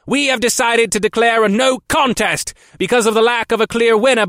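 high, loud booming gargoyle voice; metallic gargoyle boom. Acted. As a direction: boom it out like a bronze statue. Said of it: "Then the lead gargoyle went on".

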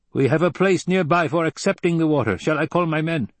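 high-pitched, matter-of-fact tribesman voice; Fremen desert fighter, blunt. A narrator's reading.

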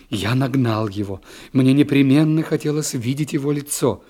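ordinary delivery

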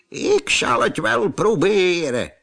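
put-on a modest old ape offers help; old ape talking. A narrator's reading. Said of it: "zei hij".

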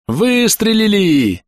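high, slow, drawn-out cry of joy; joyful shout. A narrator's reading. Gunner grinning after the enemy's shot, joyful shout. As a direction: cry out in delight, stretching the word. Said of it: "радостно закричал фейерверкер".

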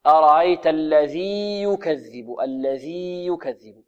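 teacher models the correct reading; slow model recitation. Shown as a demonstration. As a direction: recite slowly with a clear ي in الذي. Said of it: "إذن".

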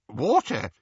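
ordinary delivery, nothing marked

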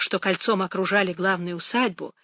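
plain reading voice

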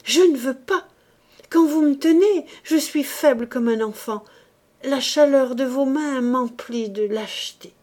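high, loud refusal, easing gradually; crying out. A narrator's reading. Woman refuses the priest's calming hands, crying out. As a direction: protest loudly as you back away. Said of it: "cria-t-elle en reculant".

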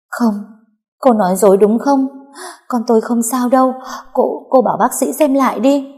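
high, loud, frantic pleading; desperate denial. A narrator's reading. Diệp begging the nurse to deny it, frantic and loud. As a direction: refuse to believe it, voice cracking upward. Mother denies lost baby.

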